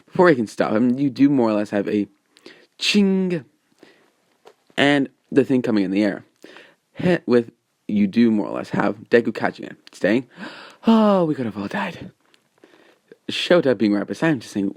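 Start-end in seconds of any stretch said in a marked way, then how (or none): 2.8-3.4 high, drawn-out "ching" sound effect; imitated metallic ching. Narrator imitating the launcher's bomb popping out, high ringing ching. 10.9-12.1 high-pitched exclamation of alarm; acted near-miss panic, starting high and falling; mock alarm. Deku catching the bomb in the air, acted high alarm.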